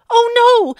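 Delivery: loud, high cry of alarm; shocked gasp. Acted. Child finding the doggy gone from the garage, loud alarmed cry. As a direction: blurt it out in sudden dismay.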